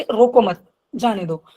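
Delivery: group "रोको मत", pause, then finish with "जाने दो"; pause after मत. Shown as a demonstration. Teacher explaining what the message means, pause after मत. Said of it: "मतलब कि".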